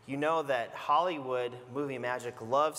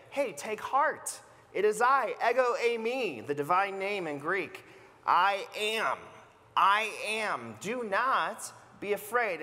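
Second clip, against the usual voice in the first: higher, slowed, reassuring call of Jesus; voicing Jesus; 6 semitones higher, 3 dB louder than usual. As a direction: call out to calm frightened friends. Pastor voicing Jesus calling out.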